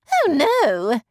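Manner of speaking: high, loud dismayed lady's cry; shocked high society lady crying out; Miss Bingley's shocked cry. A narrator's reading. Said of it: "Miss Bingley exclaimed".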